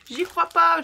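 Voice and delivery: loud, high cry of disbelief; can't believe it's been stolen. Real, not acted. To viewers, shocked that a stamp was stolen. Finds a stamp missing from pack.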